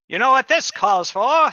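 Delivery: loud, high showman's call; carnival barker. Acted. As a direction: pitch it like a fairground barker. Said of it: "says the anachronistic, uh, carnival barker".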